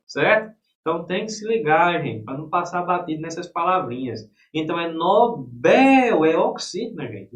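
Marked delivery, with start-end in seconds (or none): 4.9-6.2 "Nobel" as no-BÉÉL, long loud last syllable; slow, loud no-BÉÉL; stress on BEL. Shown as a demonstration. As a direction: punch and hold BEL in "Nobel". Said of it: "a sílaba tônica é a última".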